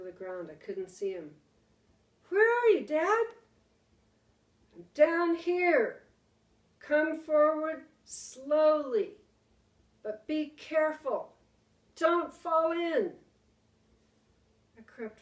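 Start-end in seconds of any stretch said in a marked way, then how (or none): 2.3-3.3 high loud searching call. Acted. Boy searching in the dark. 4.7-13.2 loud, slow careful call; urgent warning. Acted. Father warning from the pit.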